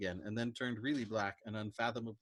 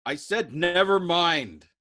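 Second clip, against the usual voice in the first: high, loud, slow scream of terror; wounded man screaming in terror at his deaf crewmate; terrified scream; 7 semitones higher, 12 dB louder, slower than usual. Acted. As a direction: scream it, trapped and afraid. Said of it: "Yossarian screamed with a trapped feeling of terror".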